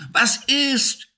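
alarmed call. Acted. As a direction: call out to your frightened friend. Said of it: "rief er". (viel höher, erschrockener Ruf)

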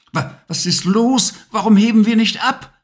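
put-on high, stammering, rushing worried boy; anxious boy. Levi asking why the broom won't lift, anxious and high. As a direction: stumble then hurry out the question in alarm. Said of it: "Ängstlich blickte Levi nach oben".

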